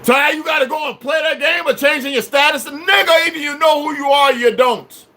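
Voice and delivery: high, indignant shouting; heated real scolding; angry challenge. Real, not acted. Berating listeners about changing status.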